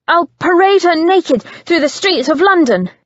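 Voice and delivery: high, loud, taunting threat; cruel goading. Acted. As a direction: bait him with each word.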